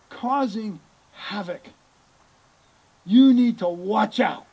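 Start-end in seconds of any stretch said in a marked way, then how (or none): 3.0-4.5 "You" hit loud, falling firmly; loud warning. Real, not acted. Preacher warning his listeners to watch out, loud. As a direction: warn the congregation sternly. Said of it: "And I mean it".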